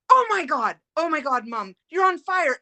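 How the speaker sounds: high, loud, alarmed cry, reliving it; retold panic. Daughter shouting to her mother whose sleeve caught fire, panicked. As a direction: yell the alarm as the sleeve burns. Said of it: "Of course, I am panicking".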